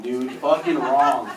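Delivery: normal talking voice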